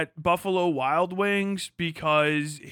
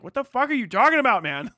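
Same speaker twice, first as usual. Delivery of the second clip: fast, louder, higher what-the-fuck; incredulous outburst; 6 semitones higher, 4 dB louder, faster than usual. Retold or imitated. Mocking sports talk, fast incredulous outburst. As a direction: blurt it out in disbelief.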